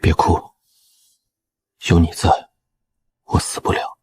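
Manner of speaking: weak voice trailing into breath; wounded reassurance. Acted. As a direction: comfort a loved one while badly hurt. Wounded general soothes crying wife. (虚弱地轻声安慰)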